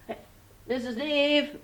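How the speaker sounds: excited high-pitched puppet shout; puppet calling out. Acted. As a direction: puppet boy calling for his teacher. Puppet calls out to teacher.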